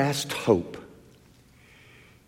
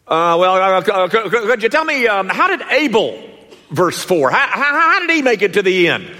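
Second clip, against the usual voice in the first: higher, faster, hesitant asker with a laugh; stammering questioner; 8 semitones higher, 5 dB louder than usual. Acted. Preacher voicing a congregant asking about Abel, halting and amused. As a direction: play a flustered student fumbling the question.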